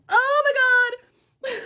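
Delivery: very high, loud groan of self-reproach; exasperated cry. Real, not acted. Admitting to believing the boyfriend, exasperated. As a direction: let it burst out high and loud.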